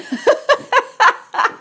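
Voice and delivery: high, loud burst of real laughter; bursting out laughing. Real, not acted. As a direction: crack up and lose it. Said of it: "I can't even say it with a straight face".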